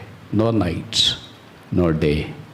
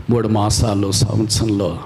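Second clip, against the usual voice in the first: ordinary talk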